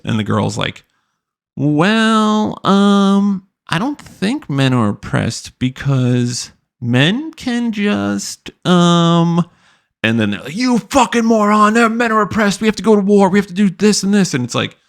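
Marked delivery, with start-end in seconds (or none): 1.6-9.5 high, slow, hesitant airhead voice; ditzy girl. Retold or imitated. Mocking the guest's hesitant answer, high and slow. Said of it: "but a bimbo". 10.5-14.3 mock screaming. Podcast hosts screaming insults at the guest, mock yell. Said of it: "they start screaming".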